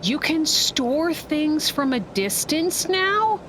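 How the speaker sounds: high, drawn-out astonished question; amazed. Real, not acted. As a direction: stretch it out in rising disbelief. Amazed by a new game feature.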